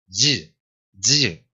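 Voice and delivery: "y" said as a short dyuh; overlapped dy sound. Shown as a demonstration. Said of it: "como una D Y ahí medio solapadita".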